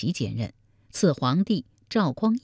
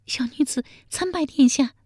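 thin, much higher timid maid's voice; frightened palace maid; 11 semitones higher than usual. A narrator's reading. A terrified palace maid kneels before the emperor, high and timid. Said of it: "一脸惊恐地说".

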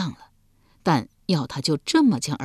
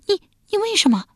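much higher, shocked outcry; startled cry; 3 dB louder, faster than usual. A narrator's reading. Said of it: "亚子惊叫一声道".

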